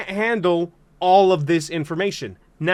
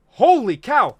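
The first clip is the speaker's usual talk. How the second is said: normal talking voice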